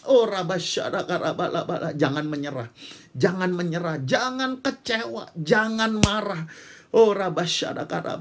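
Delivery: fervent tongues prayer leading the church, building to full force. Acted. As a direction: pray over them in tongues, rising to a cry. (doa bahasa roh yang berapi-api, makin lama makin keras)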